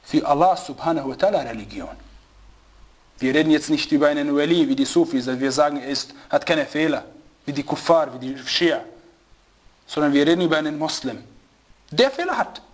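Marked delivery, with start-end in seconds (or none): none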